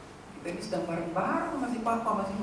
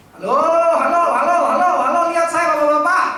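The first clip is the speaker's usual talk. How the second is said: loud, higher calls for attention; calling out; 8 semitones higher, 11 dB louder, faster than usual. Real, not acted. Preacher calling fathers to look at him, loud calling. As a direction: wake up the fathers.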